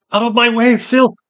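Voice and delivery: high, loud, imperious shout; furious high-pitched order; commanding cry. A narrator's reading. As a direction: cry out to clear the way. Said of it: "Seret cried".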